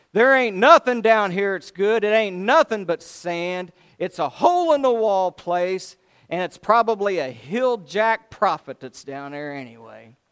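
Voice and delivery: loud, sneering complaint about the place; proud general scorning Samaria, loud and sneering; scornful rant. Retold or imitated. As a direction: bellow your contempt for this dump. Naaman's scornful rant acted.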